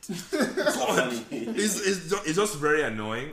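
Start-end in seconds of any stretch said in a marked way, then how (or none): 0.1-1.1 high laughing "God"; half-laughing annoyance; exasperated groan. Retold or imitated. About the coworker's refusal, laughing exasperation. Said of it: "but I'm like".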